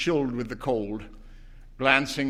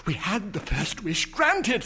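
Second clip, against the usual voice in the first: feverish; 9 semitones higher than usual. A narrator's reading. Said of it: "said the old woman feverishly".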